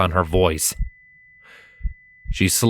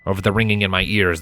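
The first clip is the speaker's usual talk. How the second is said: plain reading voice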